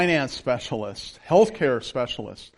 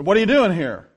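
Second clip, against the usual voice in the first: lightly voiced authority figure, louder; commanding stranger; 3 semitones higher, 6 dB louder than usual. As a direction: demand it like you own the land. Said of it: "his authority just kinda knocked me over".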